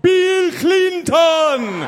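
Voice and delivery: high, loud, very slow Jamaican shout; bad Jamaican accent. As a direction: belt it out in a hammy Jamaican accent. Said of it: "I gotta do a Jamaican accent".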